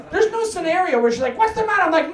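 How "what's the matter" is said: high, alarmed mom picking up the phone; mother answering her son's call in instant alarm, higher pitched; nervous mom. Retold or imitated. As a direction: blurt it like a worried mother. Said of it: "She'll go".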